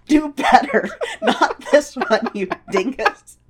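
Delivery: a laughing imitation of the female penguin; laughing mock scold. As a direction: scold him while cracking up. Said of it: "she would be all like".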